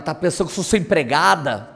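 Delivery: normal talking voice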